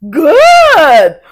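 loud praise. Real, not acted.

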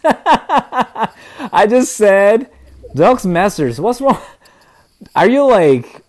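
loud, high-pitched disbelief at being questioned; exasperated. Real, not acted. Streamer scolding a prying viewer in the game, loud exasperation.

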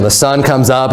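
ordinary talk

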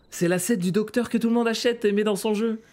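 high-pitched astonished exclamation; amazed recognition. Real, not acted. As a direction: exclaim it high, amazed at what you spotted. Recognises an overused game asset.

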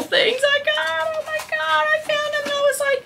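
excited squeal. Real, not acted. Excited about cute bottles found.

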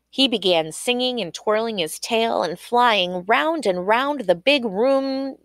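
plain reading voice

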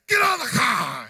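mad, high-pitched yell; Doctor Marvin. Retold or imitated. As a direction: imitate an enraged scream from a film. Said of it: "it gets mad at him".

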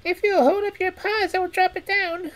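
very high squeaky rat voice; eager, relieved little rodent. Acted. Rat speaks.

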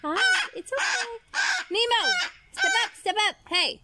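high loud coaxing to the bird; high coaxing pet talk. Real, not acted.

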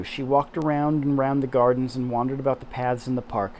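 usual speaking voice

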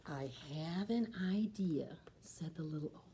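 soft whispered plan; sharing a clever idea with the kids; secret whisper. A narrator's reading. Old lady shares a secret plan.

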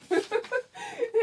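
a high, embarrassed laugh at herself. Real, not acted. Laughing at herself after retelling the panic.

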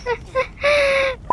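high relieved giggle; real laugh after being startled; nervous laugh. Real, not acted. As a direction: laugh off the fright.

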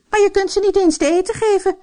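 hammed-up cross stepmother; angry. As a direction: snap at her husband, pitch way up. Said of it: "zei de vrouw boos".

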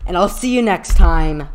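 loud, excited farewell shout; triumphant loud outro; hyped sign-off. Real, not acted. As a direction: holler goodbye still buzzing from the win. Hyped sign-off after win.

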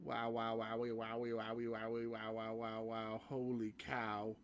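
low, soft run of wows on one note; stunned murmur. Real, not acted. Gamer dazed by the trailer, stunned murmur. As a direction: keep babbling it quietly, dazed.

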